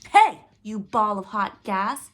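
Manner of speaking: loud, slow insult; screaming insult. Acted. Coyote yelling slowly at the sun, loud. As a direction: drag the insult out. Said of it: "screaming insults at the sun".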